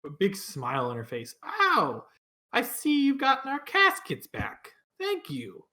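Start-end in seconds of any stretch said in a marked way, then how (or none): 1.4-5.6 put-on high, bright old woman greeting; sweet elderly townswoman; old lady voice.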